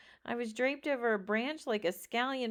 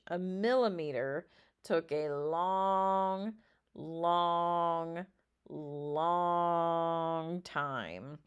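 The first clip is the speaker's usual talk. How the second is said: drawn out; 3 semitones lower, much slower than usual. A narrator's reading. Stretching out a scary wait.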